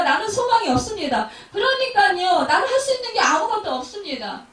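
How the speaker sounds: loud, high pleading confession; desperate prayer. Shown as a demonstration. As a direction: confess helplessness out loud to God. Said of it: "이렇게 기도해야 되는 거예요". (높고 크게 간절히 고백하는 기도)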